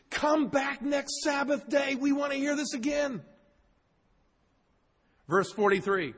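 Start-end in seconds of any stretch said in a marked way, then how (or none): 0.1-3.2 begging crowd. Retold or imitated. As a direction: call out like a pleading crowd, much higher. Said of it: "People are begging".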